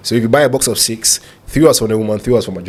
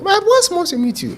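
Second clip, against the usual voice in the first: put-on tiny, much higher voice of a young lady. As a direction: squeeze it into a tiny girlish voice. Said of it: "with a very tiny voice".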